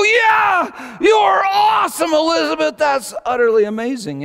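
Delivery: high, full-voiced cheer; cheering dad. Dad praising his daughter on the slide, loud excited cheer. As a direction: whoop with huge fatherly praise.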